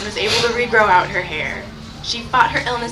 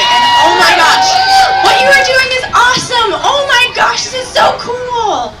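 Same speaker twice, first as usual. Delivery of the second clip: high, loud gushing praise; thrilled, slowing down on the last words; excited gushing; 13 semitones higher, 10 dB louder than usual. Real, not acted. Gushing to kids about fundraising.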